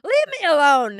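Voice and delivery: loud, fed-up snap at the app; exasperated outburst. Real, not acted. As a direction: shove the nagging app away, loud.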